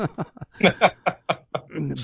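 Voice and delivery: high chuckling laugh; amused, high-pitched chuckle. Real, not acted. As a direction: laugh along at the joke. Joking about departing this earth.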